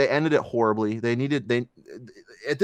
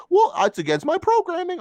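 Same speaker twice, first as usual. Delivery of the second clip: much higher fussy droid whine; mock the prissy robot complaining; squeaky, prim protest at being wiped; whiny C-3PO; 4 dB louder than usual. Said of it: "three P O bitching and moaning".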